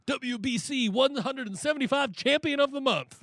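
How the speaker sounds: high, mocking proclamation voice; mock announcement. Mocking the WBC post, a high mock announcement. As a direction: pitch it up like a grand proclamation.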